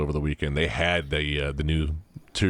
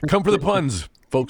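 higher, louder emcee call; announcer voice; 10 semitones higher, 4 dB louder than usual. Acted. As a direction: play it like a showman. Joking announcer aside.

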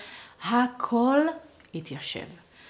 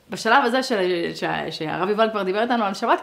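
ordinary talk

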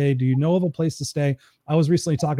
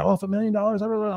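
mock excited crowd; 6 semitones higher than usual. Crowd excited about million dollars.

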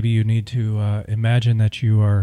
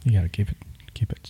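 whisper; 2 semitones lower, 7 dB quieter, faster than usual. Real, not acted. Aside whisper.

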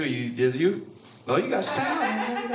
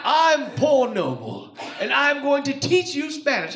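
mock British narrator; 6 semitones higher, slower than usual. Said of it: "The dude is British".

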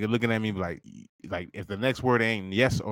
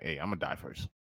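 deadpan resolve; 10 semitones lower, 12 dB quieter than usual. Retold or imitated. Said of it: "I'm like".

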